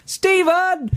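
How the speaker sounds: very high-pitched comic call; mock shout. Lone voice heckles a gig, high mock shout. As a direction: cry the name out high across a hall. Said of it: "a lone voice from the right of the auditorium shouted".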